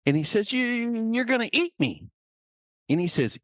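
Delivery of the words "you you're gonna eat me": high, stammering fear; small scared voice, acted; frightened chick. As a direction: stumble on the first word, pitched high. Frightened chick answers the predator.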